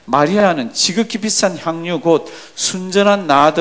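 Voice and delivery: loud, slow, measured Bible recitation; scripture reading aloud. Acted. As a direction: lead the congregation through the verse, strong and drawn out. Re-reads the verse aloud. (크고 느리게 또박또박 성경 봉독)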